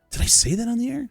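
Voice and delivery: high, incredulous question, acted; puzzled disbelief. Radio host to a fan on the street, baffled.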